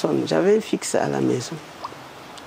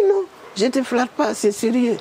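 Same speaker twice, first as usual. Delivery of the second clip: much higher earnest insistence; insisting Prime Minister; 7 semitones higher than usual. Retold or imitated. Woman voicing the Prime Minister protesting, much higher. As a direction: protest that it's serious.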